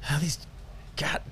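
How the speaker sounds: muttered under the breath; whispered grumble. Acted. Officer muttering at machine.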